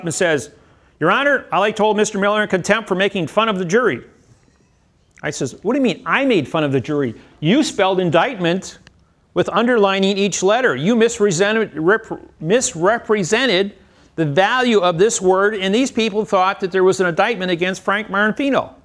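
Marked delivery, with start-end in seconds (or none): 1.0-4.0 raised pitch, quick retold objection; DA's objection. DA complains to the judge about Miller, acted indignant objection. 5.6-18.7 higher-pitched, emphatic courtroom comeback; retold indignant rebuttal. Defendant accuses the DA over the indictment, retold indignant rebuttal.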